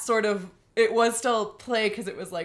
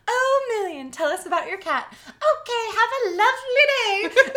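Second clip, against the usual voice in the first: flirty; 8 dB louder than usual. Retold or imitated. Flirty voice to guy.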